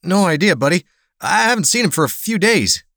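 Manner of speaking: high, nervous bartender trying to sound friendly; forced friendliness, tremor. Acted. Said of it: "tried to sound friendly, but couldn't hide the tremor".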